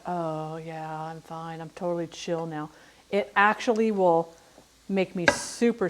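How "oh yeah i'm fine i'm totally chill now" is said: soft, slow drowsy chill voice; a lightly acted doped-up calm; mock sedated calm. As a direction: play it drugged and mellow, trailing softly. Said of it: "Instead of being like".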